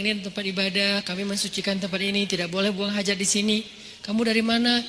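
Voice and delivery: soft, low, unhurried voice; gentle explaining. Retold or imitated. Prophet explaining to a newcomer, gentle. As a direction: explain it kindly and quietly.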